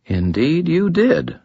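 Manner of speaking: high, slow, knowing agreement; lightly voiced woman savoring the point; Lashiel, amused.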